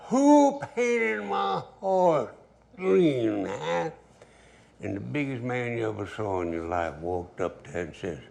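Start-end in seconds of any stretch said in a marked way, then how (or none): 0.1-3.9 much higher, louder, very slow shout; furious holler. Retold or imitated. Angry owner demands who painted horse, loud high bellow. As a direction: bellow it so the whole hall stops. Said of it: "And he hollered".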